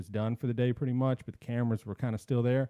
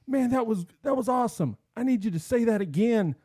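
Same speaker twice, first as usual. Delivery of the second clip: excited producer; 8 semitones higher than usual. Retold or imitated. Producer voiced, raised.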